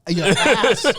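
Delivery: teasing line breaking into laughter; laughing. Real, not acted. Streamer teasing viewer about Robo's brothers, laughing.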